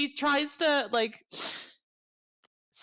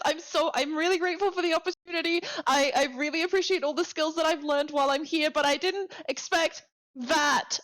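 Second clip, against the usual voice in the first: flustered nervous rambling; 6 semitones higher than usual. Acted. Intern rambles nervously to boss.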